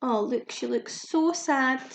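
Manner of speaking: usual speaking voice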